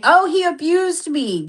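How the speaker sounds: high, slow whiny mimic; mocking whine. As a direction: whine it like a fake victim.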